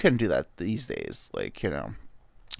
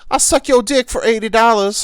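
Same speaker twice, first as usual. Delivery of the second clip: very high, loud put-on hawker; hammed-up streetwalker's sales pitch; prostitute voice; 12 semitones higher, 10 dB louder than usual. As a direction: shout the offer in a high character voice. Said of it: "That's just the prostitute voice".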